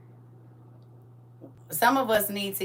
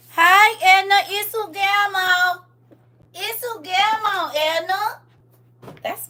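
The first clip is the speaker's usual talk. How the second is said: very high, loud, slow sing-song greeting; squeaky, stretched grandma hello; baby-greeting voice; 12 semitones higher, 8 dB louder, slower than usual. Acted.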